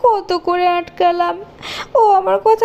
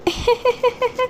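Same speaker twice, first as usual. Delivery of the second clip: put-on high, eerie child's laugh; spooky high-pitched titter; ghostly giggle; 5 semitones higher, 4 dB quieter than usual. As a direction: giggle like a haunting little ghost. Ghost girl appears.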